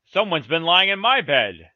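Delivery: loud middle-sized bear complaint; lightly voiced middle bear, loud; middle bear's middle voice. A narrator's reading. Middle bear finds his bed disturbed, middle voice. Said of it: "in his middle voice".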